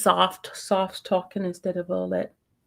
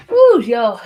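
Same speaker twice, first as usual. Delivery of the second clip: loud woo; loud delighted woo; exclaim; 9 dB louder than usual. Real, not acted. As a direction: whoop at the viewers. Exclaiming over gray hair.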